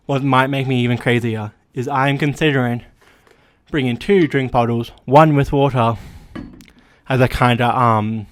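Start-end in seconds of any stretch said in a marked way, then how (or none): none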